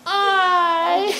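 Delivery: put-on crying toddler. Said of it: "left crying and said".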